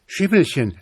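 put-on boy calling his horse in alarm. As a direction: urge "Schimmelchen" on in a high, pressing call. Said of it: "rief der Junge".

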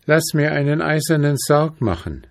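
put-on high, eerie, commanding girl's voice; commanding princess voice. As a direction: order it high and ominous.

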